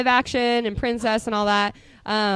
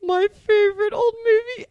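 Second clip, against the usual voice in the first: excited; 12 semitones higher than usual. Real, not acted. Gushing over favorite movie.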